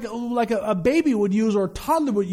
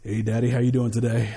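lower, offhand voice greeting God as Daddy; overly familiar greeting; 12 semitones lower than usual. Pretend. Preacher imagines greeting God casually from the pulpit, lower and offhand. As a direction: toss off a casual hey to God. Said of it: "I was like".

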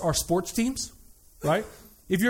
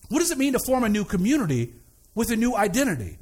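ordinary delivery

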